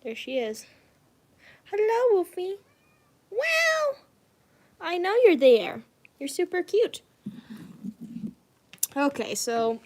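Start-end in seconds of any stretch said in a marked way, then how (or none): 1.7-4.0 much higher squealy hello to the cat; excited pet greeting. Real, not acted. Greeting her cat on camera. 4.8-7.0 pet talk. Real, not acted. Cooing to her cat.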